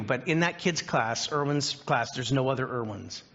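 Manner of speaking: ordinary talk, nothing marked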